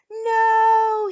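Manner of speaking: long, high, full-voiced cry; falling cry. A narrator's reading. Eric tumbles into the air, long loud cry. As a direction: cry out as the ground vanishes. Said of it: "he cried".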